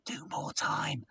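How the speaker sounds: stage whisper, voice dropped right down; whispered instruction. Retold or imitated. Stage manager whispering to the compere, urgent hush. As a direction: hiss it across the stage, unseen. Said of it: "shaking his head and going".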